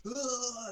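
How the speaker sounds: a sudden high strangled grunt; pained groan. Retold or imitated. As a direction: groan like the life is sucked out of you. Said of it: "you're like".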